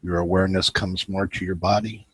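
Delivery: guided meditation. Acted. Host guiding his viewers into relaxation, calm guided meditation. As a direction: ease the listeners into stillness.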